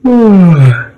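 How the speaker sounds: a long high sleepy sigh. A narrator's reading. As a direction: let out a drowsy child's sigh. Bunny sighs sleepily.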